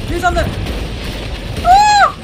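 high, loud yells under fire; alarmed shouting. Real, not acted. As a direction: shout urgently for help in a gunfight.

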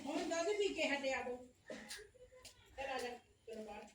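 put-on high, sing-song coaxing to a toddler; baby talk. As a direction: coax the little one gently. Mother coaxing her little child. (ਬੱਚੇ ਨਾਲ ਤੋਤਲੀ ਲਾਡ ਭਰੀ ਬੋਲੀ)

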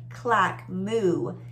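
ordinary delivery, nothing marked

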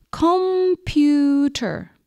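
computer split slowly into three beats; syllable by syllable. Shown as a demonstration. Teacher clapping out a word for pupils, slow syllables. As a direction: say "Computer" as com-pu-ter, slow and separated. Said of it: "The word has been broken up for you".

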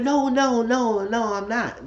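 high, loud panicky no no; mock a frantic high-pitched denial.

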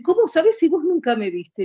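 retold scammer feigning offended surprise; indignant scammer. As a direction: imitate his offended protest, pitched well up. Scammer protests, quoted in argument.

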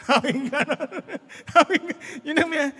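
laughing through the words; chuckling as the joke lands. Real, not acted. Preacher laughing at his own epitaph joke, laughing.

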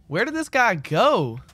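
loud, high, drawn-out cry; exasperated bafflement. Real, not acted. Gamer searching for the missing opponent, exasperated bafflement. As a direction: wail it slowly in total bafflement. Opponent vanished during a duel.

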